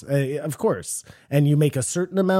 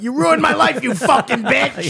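furious outburst; 11 semitones higher, 5 dB louder than usual. Acted. Husband meaning to ask honey for sugar, furious blurted yell. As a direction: blurt it as a hammed-up marital explosion.

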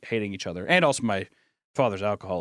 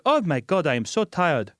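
higher-pitched mock Polynesian accent; hammed-up Polynesian accent, pitched up; thick Polynesian accent; 5 semitones higher than usual. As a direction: imitate a friend's tired mom with a thick Polynesian accent. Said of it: "I was so shocked by how thick her accent was".

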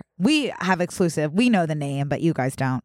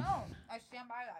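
muffled by food in the mouth, softer; chewing while talking; 7 semitones higher, 19 dB quieter than usual. Real, not acted. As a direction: mouth full, still chewing. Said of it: "Sorry, I'm chewing".

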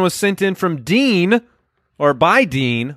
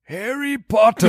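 wizard voice; 5 semitones higher, slower than usual. Acted. Joke callback to the wizard voice.

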